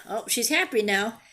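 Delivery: ordinary delivery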